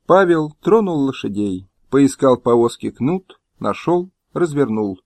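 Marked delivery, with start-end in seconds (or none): none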